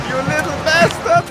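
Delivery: mock villain quote. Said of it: "sagt er".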